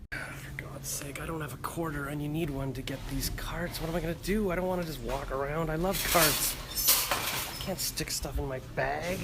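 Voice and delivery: whispered, exasperated muttering to herself; frustrated whisper. Real, not acted. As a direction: grumble under your breath in a shop. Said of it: "whispering in a store".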